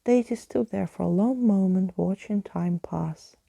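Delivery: usual speaking voice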